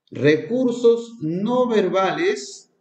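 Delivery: "no" lifted and drawn out, slowly; stress on no. Shown as a demonstration. Teacher pointing teachers to the word no, slow stress. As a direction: lean on "no" in "recursos no verbales", slow. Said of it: "miren acá, maestros".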